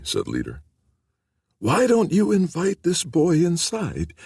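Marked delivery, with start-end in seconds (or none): 1.6-4.1 high, slow voice of a frail wife; gentle, ailing woman. A narrator's reading.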